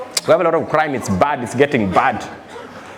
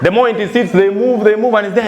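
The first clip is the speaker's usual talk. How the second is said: ordinary talk, nothing marked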